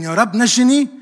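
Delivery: loud, high acted plea; cry of faith. A drowning sinner calls on the Lord, crying out in faith. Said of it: "بيصرخ صرخة الإيمان".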